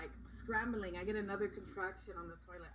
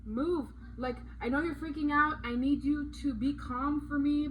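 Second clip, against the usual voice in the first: louder, higher retold plea; urgent insistence; 6 semitones higher, 11 dB louder than usual. Wife retelling orders to her panicking husband, urgent and insistent. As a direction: press him firmly to pull himself together.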